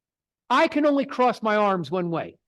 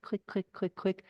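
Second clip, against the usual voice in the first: soft clicking; 2 semitones lower, 10 dB quieter than usual. Shown as a demonstration. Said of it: "You just go click".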